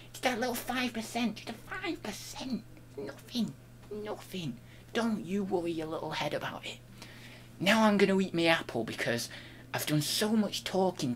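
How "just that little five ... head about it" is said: very soft, coaxing whisper-like hush; hushed reassurance. Acted. To viewers worried about the change, a very soft soothing hush. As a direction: soothe them like a child, barely audible.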